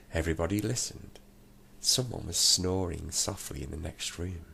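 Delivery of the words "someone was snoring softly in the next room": usual speaking voice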